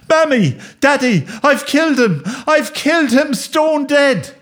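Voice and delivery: high-pitched panicked cry; frantic wailing. Acted. Boy bursting in to confess to parents, frantic and high.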